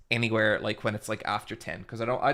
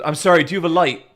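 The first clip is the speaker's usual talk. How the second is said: hurried request; 3 semitones higher, 8 dB louder, much faster than usual. Retold or imitated. Quotes the panting stranger, hurried.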